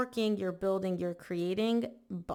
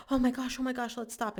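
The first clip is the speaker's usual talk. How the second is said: mock panic; 6 semitones higher than usual. Reader voicing a panicking Libra wanting to stop, hammed-up panic. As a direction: rattle it off like someone losing their nerve. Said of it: "you're freaking out and you're like".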